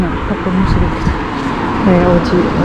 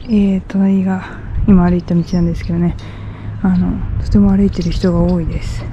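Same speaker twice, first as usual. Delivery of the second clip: normal talking voice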